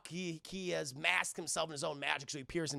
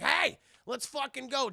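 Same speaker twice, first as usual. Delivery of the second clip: high, loud "hey" to start a fight; rallying call; 8 semitones higher, 6 dB louder than usual. Retold or imitated. Gargoyles charging into the trap, loud high rallying call. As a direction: call out a mock battle cry.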